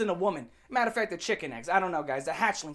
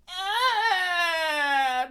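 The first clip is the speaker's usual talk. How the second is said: high, yelled wail; mock tantrum wail; 8 semitones higher, 3 dB louder than usual. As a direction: cry out like a sulking kid. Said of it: "it's gonna be".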